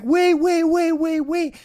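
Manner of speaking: high, loud calls of wait; calling after them. Retold or imitated. Girl chasing the departing car, high loud calling. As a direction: shout it while chasing the car.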